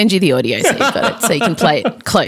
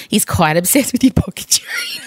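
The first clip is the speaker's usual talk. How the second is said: whisper; 5 semitones higher than usual. Retold or imitated. Whispered quote to Jock.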